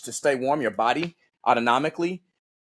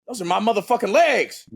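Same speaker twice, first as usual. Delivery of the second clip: retold shocked realisation; 8 semitones higher, 3 dB louder than usual.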